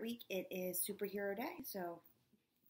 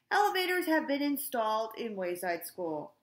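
booming, much louder announcement voice; principal on the loudspeaker; 3 semitones higher, 8 dB louder than usual. A narrator's reading. Mister Kitzwatter greets the school over the loudspeaker, booming loud. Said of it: "voice came o-, over the loudspeaker".

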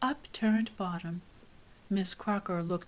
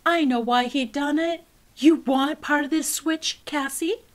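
higher, louder warning; stern threat; 6 semitones higher, 8 dB louder than usual. Acted. Teacher threatening Cassie with the switch, stern. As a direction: threaten the girl sharply.

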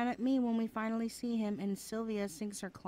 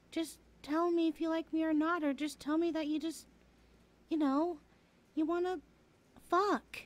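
higher and louder, exasperated demand; frustrated outburst; 6 semitones higher, 3 dB louder than usual. Real, not acted. Tired of reading his mind, she blurts out frustrated. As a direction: blurt it out, fed up with guessing.

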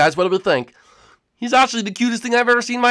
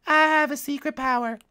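Kirby voice; 5 semitones higher, 5 dB quieter than usual. Acted. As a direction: say it like a tiny pink puffball. Kirby reveals power.

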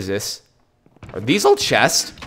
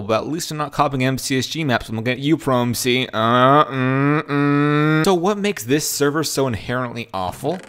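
ordinary talk, nothing marked

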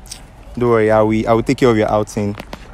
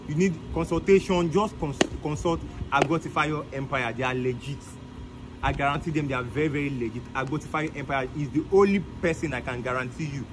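softer sponsor pitch to camera; ad read; 5 semitones higher, 11 dB quieter than usual. Acted. Promoting Agbotifayo Empire to his viewers, ad read. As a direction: sell it straight to the viewers, calm and persuasive.

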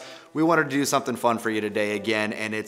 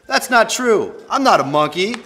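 high, loud, mock-outraged denial; indignant protest; 8 semitones higher, 7 dB louder than usual. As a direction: protest loudly against the book. Protests what the book says.

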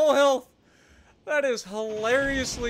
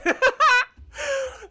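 loud, high cackling laugh; high burst of laughter; 5 dB louder than usual. Real, not acted. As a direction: crack up at the accidental kill. After surprise kill.

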